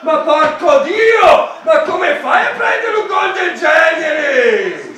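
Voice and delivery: furious shout. Real, not acted. Fan furious at conceded goal.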